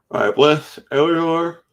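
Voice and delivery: words stretched through a big yawn; yawning while reading names. Real, not acted. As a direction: talk mid-yawn, mouth wide open. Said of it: "sorry for the yawning".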